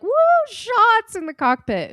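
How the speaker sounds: high whooping party voice; mock party cheer. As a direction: cheer like a tipsy pilot. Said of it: "they were both like".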